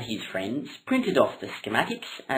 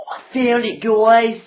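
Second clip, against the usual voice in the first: excited; 8 semitones higher, 8 dB louder than usual. Acted. Excited announcement.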